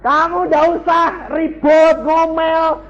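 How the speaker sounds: high, loud, slow shouted rebuke; preaching at full force. Acted. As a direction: shout it down at the wives.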